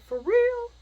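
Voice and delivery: very high, loud incredulous question; shocked disbelief. Retold or imitated. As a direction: shoot the pitch up in disbelief. Acquaintance reacts to her news.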